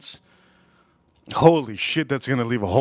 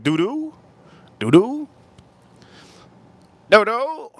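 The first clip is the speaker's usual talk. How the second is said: high, rising "Doo-doo" repeated, louder each time; 8 semitones higher, 5 dB louder than usual. Retold or imitated. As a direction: chirp each "Doo-doo" higher in a put-on accent. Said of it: "That's how the Asians talk".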